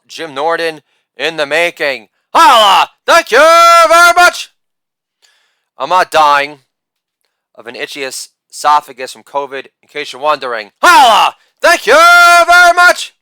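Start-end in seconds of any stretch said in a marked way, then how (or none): 2.3-4.5 loud "Holla", then a very high, loud "thank you very much"; hammed-up falsetto victory call; catchphrase shout. 10.8-13.1 loud "Holla", then a very high, loud "thank you very much"; hammed-up falsetto victory call; catchphrase shout.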